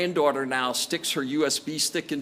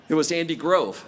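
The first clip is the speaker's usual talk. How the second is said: ordinary talk, nothing marked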